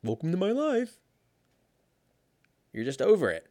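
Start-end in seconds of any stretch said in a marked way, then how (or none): 0.0-1.0 very high, put-on catchphrase; mock exasperation. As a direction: squeeze the complaint up high for comedy. Acted catchphrase, repeated bit.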